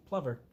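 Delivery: "Plover" said with a short u as in "lover"; corrected pronunciation. Shown as a demonstration. Trying the TV show's pronunciation of "Plover". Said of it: "That's how they pronounce".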